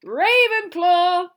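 long, high, booming announcement; drawn-out full-voiced yell; triumphant shout. A narrator's reading. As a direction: shout it out to the whole hall, stretching it. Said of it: "shouted the hat".

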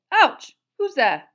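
loud, high yelp and challenge; yelp, then demand who it is; startled cry. A narrator's reading. Wizard bumped into in the dark, startled and loud. Karkaroff is knocked into in darkness.

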